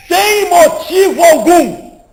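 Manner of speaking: slow, loud, high preaching shout; emphatic shout. Real, not acted. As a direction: drag each word out and shout it. Preacher stressing causeless conflict.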